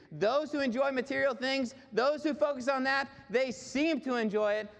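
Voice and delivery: high, driving sermon climax; fervent preaching. Real, not acted. Preacher urging listeners to live for eternity, fervent preaching. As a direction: press the promise on the congregation.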